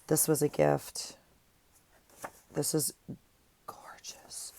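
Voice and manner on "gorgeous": breathy admiring whisper. Real, not acted. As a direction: whisper it in awe at something beautiful. Admiring a gifted journal page.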